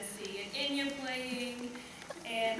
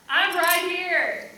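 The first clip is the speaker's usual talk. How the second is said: loud, higher, drawn-out insistence; loud protest; 4 semitones higher, 8 dB louder, much slower than usual. Acted. Woman insisting to lover that she is present, loud protest. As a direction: stretch it out in loud exasperation.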